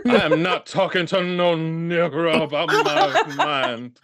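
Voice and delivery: slow mock Southern drawl; hammed-up Southern put-on; Southern accent. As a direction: refuse in a caricature Southern accent, drawn out. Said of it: "Them fucking people from the South".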